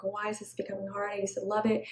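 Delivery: soft unvoiced whisper of inner doubt; whispered thought. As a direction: whisper it to yourself, puzzled.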